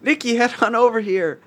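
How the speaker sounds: high-pitched summons; calling out. Pretend. Host hailing an arriving guest, calling out in a high voice. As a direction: call someone over from across the room.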